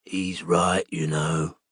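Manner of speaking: low, soft, gravel-voiced whisper; smoker's growl of an older office manager. A narrator's reading. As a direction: growl it under the breath. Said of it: "the low growl that passed for her whisper".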